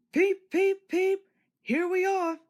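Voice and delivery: very high, loud whistling call; joyful whistle. A narrator's reading. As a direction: whistle out arrival. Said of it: "whistled Thomas".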